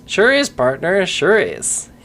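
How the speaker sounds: hammed-up Western cowboy accent with a drawn-out "partner"; mock cowboy drawl. Streamer keeps the cowboy accent going, drawing out "partner". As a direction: lean into a folksy Wild West accent. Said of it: "he doesn't talk like that".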